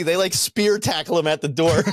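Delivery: usual speaking voice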